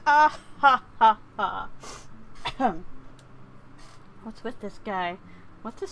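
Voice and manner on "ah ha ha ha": loud hammed-up ha ha ha, falling away; a staged villain laugh for a joke; over-the-top fake laughing; mock laugh. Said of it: "I'll now laugh in Japanese".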